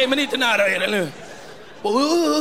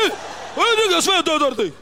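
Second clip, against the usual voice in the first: mock angry threat; 4 semitones higher than usual. [name] hotar.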